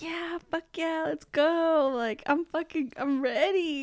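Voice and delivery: put-on hyped drunk agreeing to the next party; eager drunk hype. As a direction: fire yourself up to keep partying. Retold eager drunk self.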